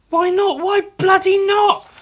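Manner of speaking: very high, loud defiant cry; exclamation. Real, not acted. Justifying looking again, very high and loud. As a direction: cheer yourself on.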